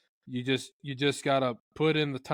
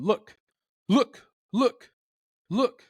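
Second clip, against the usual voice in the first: voicing a clucking turkey, higher and louder; calm clucking turkey; 7 semitones higher, 5 dB louder, much slower than usual. Hunter voicing the turkey's cluck for a listener, lightly pitched up. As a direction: call look look look like a relaxed cluck. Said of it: "a turkey's going".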